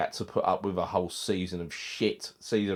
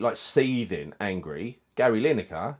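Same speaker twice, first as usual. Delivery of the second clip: ordinary delivery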